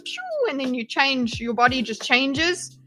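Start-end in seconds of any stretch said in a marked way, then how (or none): 0.0-0.5 high-pitched "phew" of a beam; imitated whoosh. Describing a body-changing beam to viewers, imitated whoosh. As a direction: make the beam's noise, high and quick. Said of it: "that just goes".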